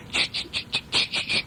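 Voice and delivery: slow, soft breathy ch-ch; polishing scrub noise. Retold or imitated. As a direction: rub the bell with a scrubbing sound. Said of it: "they're going".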